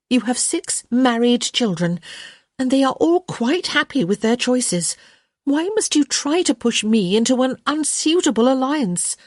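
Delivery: put-on young woman. Daughter pleads with mother.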